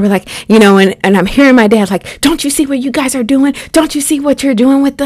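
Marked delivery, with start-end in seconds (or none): 2.2-5.1 high-pitched imitation of an indignant dad; dad going off. Dad confronting armed officers, imitated going off. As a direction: scold them in a raised pitch, without shouting. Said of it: "he's just going off on them".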